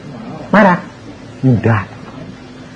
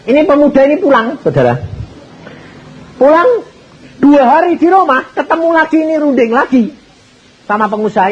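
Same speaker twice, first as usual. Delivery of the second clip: excited storytelling; 10 semitones higher than usual. A narrator's reading. Preacher building up the story.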